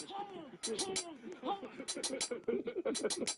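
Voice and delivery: high goofy honks; goose honking. Acted. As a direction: honk like a cartoon goose.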